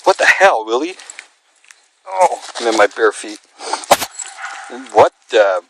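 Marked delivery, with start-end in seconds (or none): 0.0-0.9 startled exclaim. Real, not acted. Dog barks at its echo.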